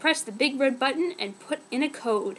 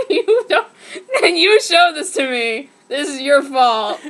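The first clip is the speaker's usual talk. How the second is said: laughing protest; 9 dB louder than usual. Real, not acted. Reader blames friend laughing.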